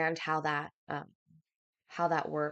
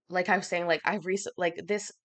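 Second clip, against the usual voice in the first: ordinary talk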